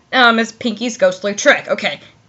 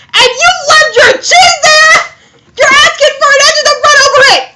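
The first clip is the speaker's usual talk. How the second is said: high, loud comic scolding; outraged shouting; 14 semitones higher, 5 dB louder than usual. Acted. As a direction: shriek in disbelief at the farmer. Acted outraged scolding over cart on line.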